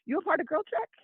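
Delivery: high-pitched imitation of curious strangers; surprised retold question. Retold strangers recognizing her hat.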